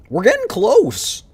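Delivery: high excited. Real, not acted. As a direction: lift it up, thrilled. Boss nearly beaten.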